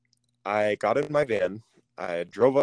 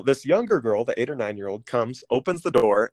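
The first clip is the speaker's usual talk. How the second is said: ordinary delivery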